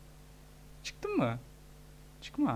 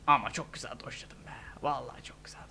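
proud whisper; 7 semitones lower, 11 dB quieter than usual. Real, not acted.

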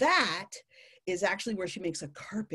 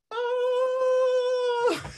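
long, high comic scream; fleeing tarantula's scream; 5 dB louder than usual. Acted. Male tarantula running for his life, long mock scream. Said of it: "he runs for his life".

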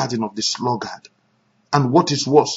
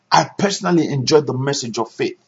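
ordinary talk, nothing marked

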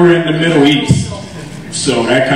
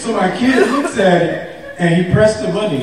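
normal talking voice

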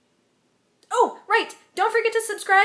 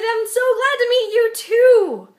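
nervous gush; 6 semitones higher, 9 dB louder, slower than usual. Retold or imitated. Nervous reply to Wil.

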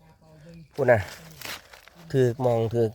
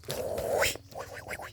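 breathy hushed whisper, no voice at all; 4 semitones higher, 9 dB quieter than usual. Real, not acted. Pointing out mushrooms to viewers, whispering. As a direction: whisper so you don't scare the find.

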